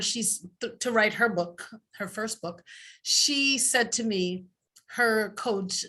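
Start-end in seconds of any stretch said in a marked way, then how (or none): none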